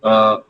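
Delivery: unaccented French E as "uh"; French E sound. Shown as a demonstration. Teacher shows class how plain E is said, French E sound. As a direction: say "uh", a short neutral vowel. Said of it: "how do we usually pronounce it".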